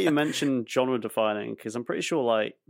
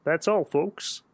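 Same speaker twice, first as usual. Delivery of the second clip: Kermit the Frog; 5 semitones higher than usual. Retold or imitated. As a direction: sign off like Kermit. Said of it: "sign us out as Kermit the Frog".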